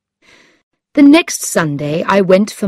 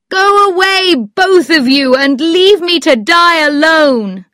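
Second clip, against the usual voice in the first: high, loud, miserable wail; anguished cry; 11 semitones higher, 5 dB louder than usual. A narrator's reading. Sick man begging to be left alone, crying out. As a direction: wail it like a suffering invalid. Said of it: "cried the unhappy man".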